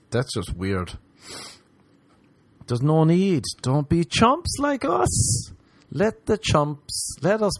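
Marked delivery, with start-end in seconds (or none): 2.7-7.6 high slow plea. Acted.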